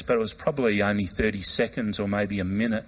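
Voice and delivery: normal talking voice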